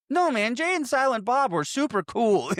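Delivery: excited protest. Retold or imitated. Loki defending Jay and Silent Bob, high eager protest. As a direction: gush in their defence. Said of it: "And Loki's like".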